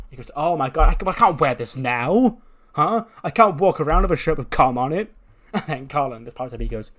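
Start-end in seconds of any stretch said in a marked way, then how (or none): 0.3-5.1 loud, high-pitched complaint; panicked protest. Acted. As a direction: wail in embarrassed dismay. Manny panicking over the shirts.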